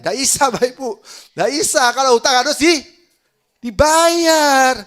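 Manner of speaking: high, very loud admonishment; full-force preacher's rebuke; pulpit scolding. Real, not acted. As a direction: shout the rule at the congregation. Preacher scolding congregation about debts.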